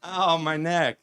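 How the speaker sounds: hammed-up delighted hello, laughing through it; exaggerated buddy greeting. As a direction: greet an old friend way too enthusiastically while being choked. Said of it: "just sort of exaggerated in his introduction or his hello".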